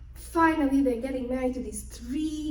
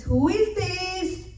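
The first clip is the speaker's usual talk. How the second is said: slow, higher questioning voice; daughter's voice; 7 semitones higher, 5 dB louder, much slower than usual. Retold or imitated. Daughter asking who knocks, slow high voice. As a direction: call through the door, wary.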